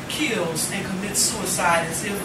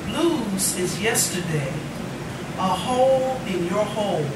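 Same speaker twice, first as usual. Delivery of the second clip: poem recitation; 3 semitones lower, slower than usual. Acted. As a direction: read it tenderly to the room. Recites poem about student's dad.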